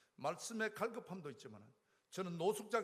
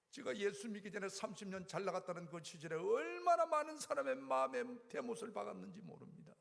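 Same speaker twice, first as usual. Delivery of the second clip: ordinary delivery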